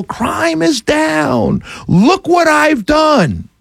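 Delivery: slow, high, drawn-out mock shout; mock yell. Politician yelling to the streets, slow mock shout. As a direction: stretch it out like a politician bragging. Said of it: "and yell".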